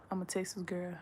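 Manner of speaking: a hushed, breathy aside, words just voiced; soft whisper of Texas pride. Real, not acted. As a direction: whisper it like a proud secret. Proud whispered declaration.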